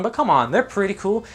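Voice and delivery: ordinary delivery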